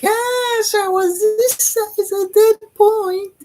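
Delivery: very high, loud, slow squeal of pride; future self gushing. Retold or imitated. Future self rewatching the video, squealing high with delight. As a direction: shriek it out, thrilled with yourself. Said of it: "I would watch my video and be like".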